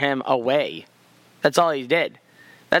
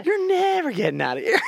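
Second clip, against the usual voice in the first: high-pitched comic callback; teasing, hammed-up verdict; mock refusal; 13 semitones higher than usual. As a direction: playfully deny parole way up high. Calls back the skit's refusal.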